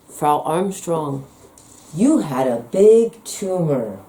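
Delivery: slow, drawn-out imitation of a condescending doctor; mocking lazy doctor. Doctors repeating their lazy excuse to the patient, mocked slowly. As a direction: parody the doctor's tired brush-off, very slowly. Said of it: "lazy ass, worthless cop-out of a response".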